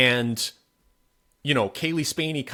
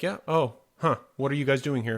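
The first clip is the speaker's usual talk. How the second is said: higher, mock-friendly soldier voice; imitating the soldier; 3 semitones higher than usual. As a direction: play the menacing soldier as falsely casual. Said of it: "he's just kinda like".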